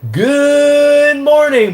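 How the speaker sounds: high, slow, drawn-out greeting; Vine greeting impression. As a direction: stretch out a cheery good morning. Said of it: "He'd be like".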